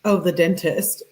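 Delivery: ordinary delivery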